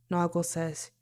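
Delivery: plain reading voice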